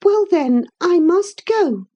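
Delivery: put-on high, loud girl's voice; hurt young lady. A narrator's reading. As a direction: announce your leaving with wounded feeling. Catherine reluctantly says she goes.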